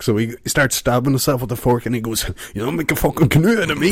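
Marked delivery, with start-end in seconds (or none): 2.5-3.9 high, rushed punchline in character; acted defiant outburst. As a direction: blurt it out in hammed-up defiance. Acting out the joke's defiant punchline.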